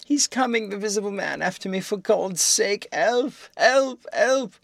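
high, loud panicked cries for help; terrified shrieking. A narrator's reading. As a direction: scream for help in terror. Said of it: "his voice shrieking with terror".

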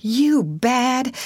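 high, tearful outburst; snuffling protest. A narrator's reading. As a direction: wail in disbelief at your friend. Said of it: "Huckleberry began to snuffle too".

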